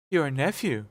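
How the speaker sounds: tender ghostly recollection; gentle ghost voice. A narrator's reading. Ghost speaks.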